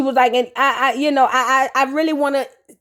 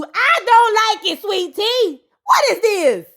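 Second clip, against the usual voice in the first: higher, slowed, disapproving; disgusted; 7 semitones higher, slower than usual. Real, not acted. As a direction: wrinkle your nose at it. Reacting to Sweet Tea's outfit.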